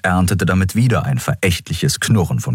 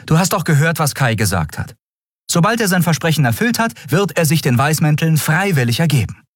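put-on much higher, quick, insistent young captain; eager defense; 12 semitones higher, faster than usual. Endriel defends Kai to the doubting crew, high and insistent. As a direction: defend Kai with hopeful conviction.

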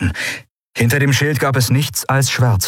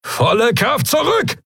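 high-pitched urgent order; commanding shout. A narrator's reading. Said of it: "befahl Thelios".

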